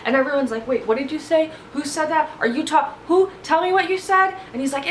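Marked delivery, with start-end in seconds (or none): 0.7-4.3 mild alarmed questioning. Retold or imitated. Everyone asking, alarmed.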